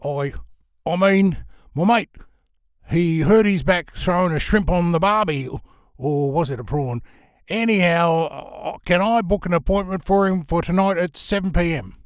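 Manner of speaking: hammed-up Aussie bluff to cover a slip; fake Australian accent. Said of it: "putting on a fake Australian accent".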